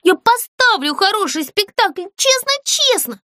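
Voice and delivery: begging with a wobbling lip; whiny pleading. Acted. Puppet begs the ghost for a chance, whiny. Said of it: "голос показался плаксивым".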